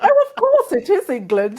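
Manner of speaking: high-pitched put-on reply; mock retort. Woman answering a shouting London driver, mock retort. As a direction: play up an obvious retort.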